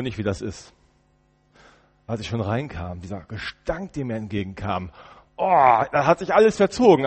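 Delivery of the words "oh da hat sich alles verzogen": a touch of disgust, voice pinched and higher; disgusted recoil. Acted. Prisoner recalling the cell's stench, lightly acted disgust. Stench hits him entering cell.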